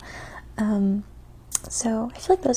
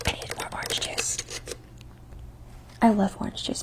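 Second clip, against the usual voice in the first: soft ASMR whisper; 4 dB quieter than usual. Acted. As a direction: whisper close to the mic.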